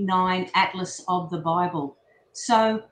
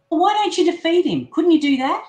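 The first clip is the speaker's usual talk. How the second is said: higher, louder and quicker, pressing hard; heated demand; 6 semitones higher, 6 dB louder than usual. Acted. Disciple urgently pressing Jesus to defeat Satan, fast raised voice. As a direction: push him for an answer, fast and loud.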